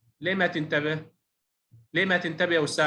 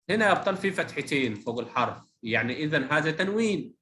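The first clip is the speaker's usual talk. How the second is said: ordinary talk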